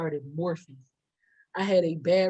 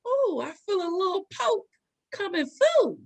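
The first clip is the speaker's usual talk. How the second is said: high mock surprise; 11 semitones higher than usual. Telling the crowd her water's breaking, high and playful. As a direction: squeak it out like it's nothing. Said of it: "And all of a sudden I said".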